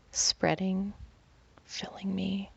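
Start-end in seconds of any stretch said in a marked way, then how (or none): none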